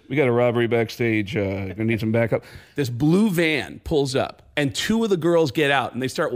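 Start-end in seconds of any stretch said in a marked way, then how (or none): 0.1-2.4 low, matter-of-fact cop radio call; low-pitched dispatch voice. Retold or imitated. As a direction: report it like an officer on the radio. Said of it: "gets on the radio".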